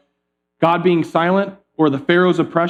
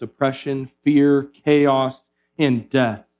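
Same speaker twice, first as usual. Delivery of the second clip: usual speaking voice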